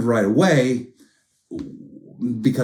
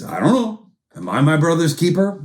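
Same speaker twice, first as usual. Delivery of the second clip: defiant Cain; 7 semitones higher, 3 dB louder than usual. Acted. Cain answers God defiantly.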